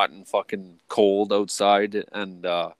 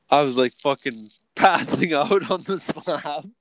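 laughing; 6 semitones higher than usual. Real, not acted. Laughing while retelling blackout.

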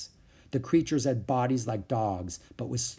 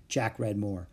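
usual speaking voice

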